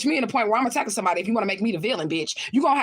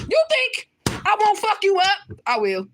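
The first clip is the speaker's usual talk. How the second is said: higher, louder, slow menacing threat; threatening challenge; 10 semitones higher, 3 dB louder, slower than usual. Real, not acted. Streamer warning trolls she will fight, slow menacing dare. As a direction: dare them slowly, voice up high.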